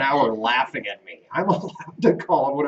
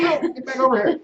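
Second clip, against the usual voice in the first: high, louder playful call; calling back; 14 semitones higher, 4 dB louder than usual. Real, not acted. Husband calling his wife back to the couch, high playful call.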